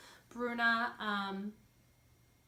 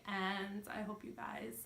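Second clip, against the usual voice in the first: whispered; 8 dB quieter, slower than usual. Real, not acted. Trailing off softly at sign-off.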